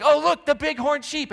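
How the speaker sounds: high, loud "oh look" exclamation; excited spotting. Retold or imitated. Passenger in the car shouting excitedly at the sheep. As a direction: point it out with delight. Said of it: "somebody's like".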